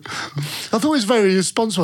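ordinary talk

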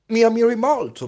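high, loud eager plea; hungry for a compliment; eager vanity. Acted. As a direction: vain man begging for praise, high and loud. Vain man fishing for praise.

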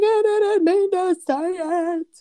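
high, drawn-out mock wail; imitated crying child. Daughter pleading after a slip of the tongue, mock crying. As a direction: wail it slowly like a tearful kid. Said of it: "Well, she starts crying".

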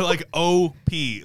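O P as two drawn-out separate letters; spelled out slowly. Shown as a demonstration. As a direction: stretch O, then P, as initials. Clarifying initials against the name Opie.

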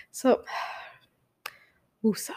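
sigh, then a voiceless whispered woosah; voiceless calming whisper to settle her anger; calming breath. Real, not acted. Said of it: "calming herself down".

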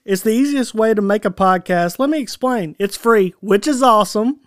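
bright, upbeat ad read. Acted. Host reading a podcast platform ad to listeners in an upbeat voice. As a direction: sell it like a podcast sponsor spot.

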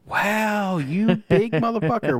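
mock amazement. Host teasing co-host about three hundred club, mock amazement. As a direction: tease a friend with hammed-up astonishment.

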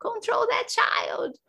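slow, strained high command; exasperated order. Retold or imitated. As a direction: drag out the order, voice high and airy. Ordering partner during labor, retold.

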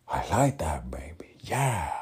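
loud, turned-on reply that jumps up at the end; Tony Montana. Acted. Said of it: "excited with his response".

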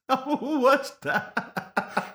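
slow, laughing through it. Real, not acted. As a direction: laugh while saying it.

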